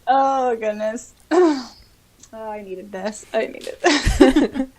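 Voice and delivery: usual speaking voice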